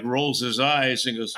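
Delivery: usual speaking voice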